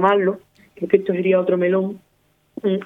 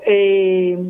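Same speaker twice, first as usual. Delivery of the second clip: ordinary talk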